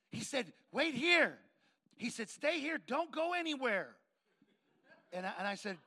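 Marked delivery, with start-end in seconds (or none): 0.7-1.4 loud, higher, excited command; urgent acted order. 2.3-3.9 high, loud, excited insistence; urgent acted order.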